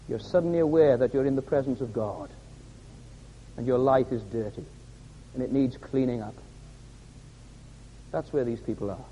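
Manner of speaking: low, soft, grave preaching; quiet, sober pulpit voice with weighty pauses; hushed solemnity. Real, not acted. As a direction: drop right down to let it sink in. Solemn drop after climax.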